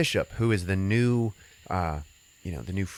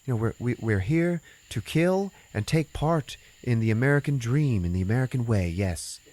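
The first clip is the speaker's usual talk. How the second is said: higher, put-on gung-ho tourist voice; mock tourist; 5 semitones higher than usual. Tourists hyped to join the Purge, higher put-on voice. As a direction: ham up the eager foreign tourist. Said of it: "And they're like".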